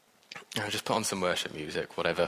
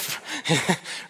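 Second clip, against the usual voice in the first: a high, unvoiced burst of laughter; breathy laugh; 8 semitones higher than usual. Real, not acted. Preacher laughing at his own bad day. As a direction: let a real laugh escape.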